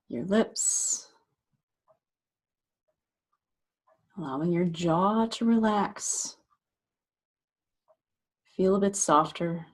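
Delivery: unhurried meditation guide, long pauses between phrases; guided meditation. Acted. Meditation teacher guiding listeners to relax shoulders and jaw, guided meditation voice. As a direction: gently talk them into settling, leaving space after each phrase.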